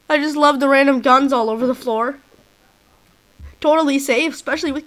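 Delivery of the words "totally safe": normal talking voice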